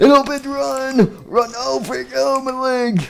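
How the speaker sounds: very high squealing panic; frantic shriek. Acted. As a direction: squeal out the escape in a frenzy. Door opens, characters flee.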